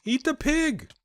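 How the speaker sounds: excited shout. Real, not acted. Player urging the bear to eat the pig, excited shout. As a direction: egg the bear on.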